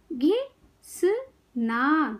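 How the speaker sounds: घिसना very slowly in three separate parts; syllable by syllable. Shown as a demonstration. Teacher teaching kids to read, syllables apart. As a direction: break घिसना into घि - स - ना. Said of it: "शब्द पढ़ना सिखाऊंगी".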